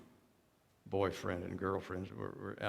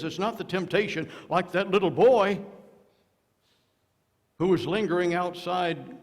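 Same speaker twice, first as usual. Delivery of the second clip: preaching at full force; 7 semitones higher, 9 dB louder than usual. Real, not acted. Preaching builds.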